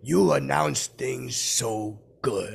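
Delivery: slow impression of a grand announcer; stretched-out announcer imitation; Morgan Freeman. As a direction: draw the words out in a put-on voice. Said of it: "I do, like, Morgan's voice".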